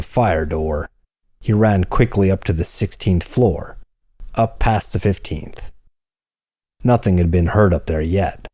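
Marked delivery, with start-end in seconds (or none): none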